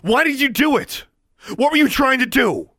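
very high, loud furious yell; shouting. A narrator's reading. Alexandra shouting at Darla, very high furious yell. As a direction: scream the questions at her. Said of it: "she shouted".

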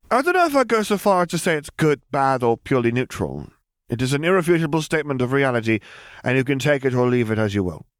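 put-on high, brisk formal girl voice; precocious girl. A narrator's reading. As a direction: lecture him like a tiny professor. Lily stated.

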